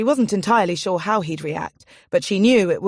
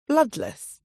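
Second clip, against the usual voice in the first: ordinary delivery, nothing marked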